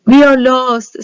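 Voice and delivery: loud panicked wail; despairing cry. A narrator's reading. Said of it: "said the people".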